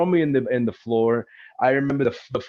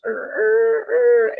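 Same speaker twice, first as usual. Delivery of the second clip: high squealing screech of tires; sharp rising tire squeal; 4 dB louder, much slower than usual. Retold or imitated. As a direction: mimic tires peeling out hard. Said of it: "hearing the tire screeching".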